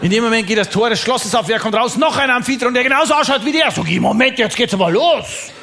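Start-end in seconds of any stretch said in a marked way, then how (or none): 0.0-3.7 high, agitated storytelling; excited reveal. Acted. Comedian tells the audience a second Amphitryon appears, excitedly. 4.0-5.5 high, slow, emphatic protest; mock outrage. Visitor protests at seeing a cloned king, mock outrage.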